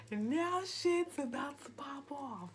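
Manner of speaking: high, slow, drawn-out announcement; hyped-up warning. Retold or imitated. Speaker warns things will pop off, theatrically hyped. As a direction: stretch it out high, relishing the drama. Dramatizes the coming fight.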